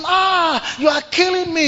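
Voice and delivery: high pained shout. Acted. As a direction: cry out as the blows land. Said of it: "He was shouting".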